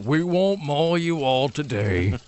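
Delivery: slow, gentle bear person. Pretend. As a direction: talk like a polite, slow bear. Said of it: "That's my, uh, bear, bear voice".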